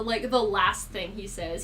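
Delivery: ordinary delivery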